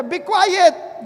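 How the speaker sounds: shouting down a beggar; crowd shout. Retold or imitated. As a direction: bark the crowd's order to hush. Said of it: "Sabi niya".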